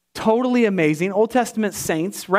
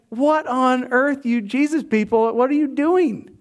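mock baffled; 6 semitones higher than usual. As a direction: drag out a stunned what on earth. Onlookers baffled by giant Jesus.

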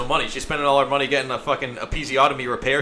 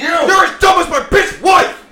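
much higher, louder, slow hammed-up yell; mock furious shout; 10 dB louder, slower than usual. Playing the husband in a joke, slow high shout. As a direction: roar it like a ranting husband.

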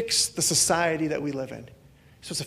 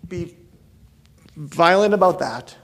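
ordinary talk

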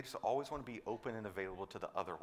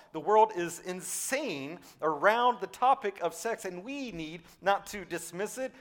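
high and loud, passionate sermon peak; full-force preaching; 8 semitones higher, 6 dB louder than usual. Acted. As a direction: preach it out to the crowd with fire.

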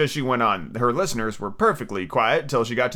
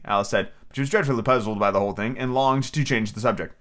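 ordinary delivery, nothing marked

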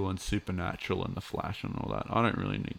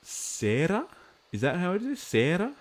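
surprised; 10 semitones higher than usual. Real, not acted. Questioning viewer's name spelling.

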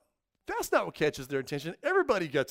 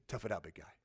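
grim encouragement; 8 semitones lower, 9 dB quieter, faster than usual. Retold or imitated. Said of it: "difference between handing you".

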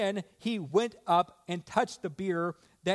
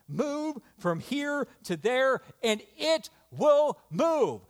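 high, rising pulpit proclamation; ringing preacher's command; commanding scripture quote; 8 semitones higher, 3 dB louder than usual. Acted. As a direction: declare it with growing force. Quoting scripture with preaching force.

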